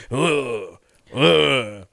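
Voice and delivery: drawn-out mock ugh; two imitated groans of dread; doctor's appalled groan. Doctor reacting to patient's polyps, imitated appalled groans. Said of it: "this fucking guy goes".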